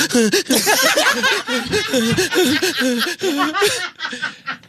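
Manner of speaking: very high gasping sob, half laughing; gasp cry. Retold or imitated. Boy sobbing in the back seat, high gasping cry. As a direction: imitate a kid's gasping cry. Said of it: "The fucking gasp cry".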